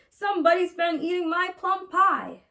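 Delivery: papa bear voice. A narrator's reading. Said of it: "growled Papa Honey Bear in his great big voice".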